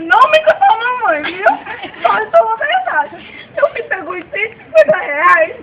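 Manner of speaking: mother sobbing to the policeman about the stolen money. Acted. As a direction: wail it out like a distraught mom begging for help. Mother confronting son, crying.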